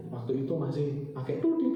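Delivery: normal talking voice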